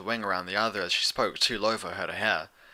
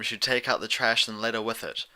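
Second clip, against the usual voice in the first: plain reading voice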